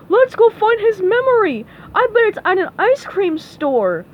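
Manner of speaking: high, loud, excited cartoon voice; eager Patrick. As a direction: blurt out a silly idea loudly.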